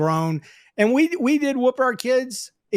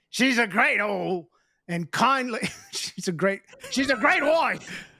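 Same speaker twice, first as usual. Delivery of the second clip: put-on Scottish drunk. Drunk Scot voiced in story.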